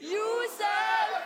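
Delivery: a loud, high call of the name; group shout. Real, not acted. A crowd of children calling Josef to come.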